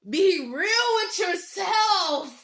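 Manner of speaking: very slow, each word drawn out; slow, deliberate plea; slow emphasis. Real, not acted. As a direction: drag it out so it sinks in.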